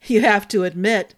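ordinary delivery

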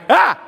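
high, loud yelp of fright; startled scream. Retold or imitated. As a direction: jump out of your skin. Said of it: "like that".

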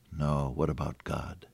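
low, soft, settled answer; calm older sister. Acted. Sister answering her brother across the room, calm. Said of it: "his sister's voice came in".

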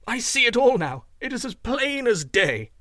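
high-pitched, emphatic realization; excited cry. A narrator's reading. Young man solves the puzzle, excited cry. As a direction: exclaim as it all becomes clear. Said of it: "he cried".

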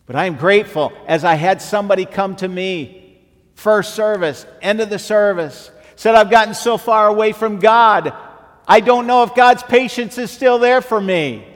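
high, loud preacher's cry; impassioned preaching near a shout; full-force preaching. Real, not acted. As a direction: belt it out to the whole congregation.

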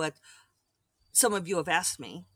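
ordinary delivery

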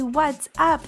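normal talking voice

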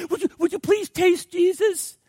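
high, groveling plea; hammed-up pleading, pitched high; mock pleading preacher. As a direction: beg like a desperate salesman. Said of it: "he's, like, pleading, he's groveling".

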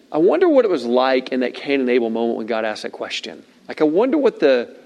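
ordinary talk

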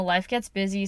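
ordinary talk

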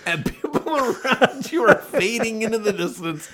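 high-pitched words through laughter; laughing while talking. Real, not acted. Coach extends his falling-climber joke to co-host, laughing. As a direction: keep describing it while cracking up. Laughing at own monologue mountain image.

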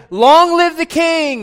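high, loud, drawn-out cheer; crowd shout. Retold or imitated. Crowd hailing Jesus as their king, full shout. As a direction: shout it out slowly like an acclaiming crowd. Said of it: "They shouted".

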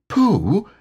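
high, small Piglet voice; solemn Piglet. Acted. Piglet solemnly answering Pooh, high and small. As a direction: say it gravely. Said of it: "he said solemnly".

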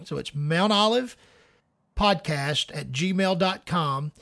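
slow, carefully spelled out; over enunciating. Shown as a demonstration. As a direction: say each word slowly and clearly. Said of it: "let me say that again slowly".

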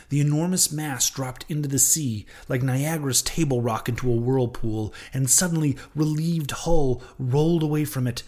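usual speaking voice

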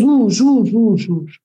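whoosh like a truck rushing by; imitated whooshing. Storyteller imitating the truck moving, whooshing noises.

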